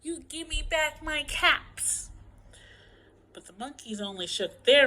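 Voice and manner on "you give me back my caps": higher, slow scolding demand; cross demand. A narrator's reading. Peddler demanding caps back from monkeys, crossly. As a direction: demand it slowly, pitched up.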